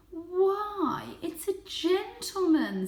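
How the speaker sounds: high, slow, marvelling mother cat; mother cat admiring the visitor, high and slow; delighted mother cat. Acted. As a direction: gasp it out in genteel wonder.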